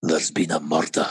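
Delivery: very deep, close-mic Scottish growl; Scottish Taggart catchphrase. Acted. Actor performing on request at the mic, very deep Scots. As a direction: drop right down into the microphone, broad Scots. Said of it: "I'll do it the microphone".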